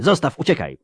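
high, pressing cry; urgent plea. A narrator's reading. Geralt urging archer to flee, urgent cry.

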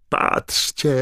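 high, loud excited shout; Bagman yelling. A narrator's reading. Commentator to the stands as Harry grabs the egg, yelling. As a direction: yell it out to the whole crowd. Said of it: "wrzeszczał Bagman".

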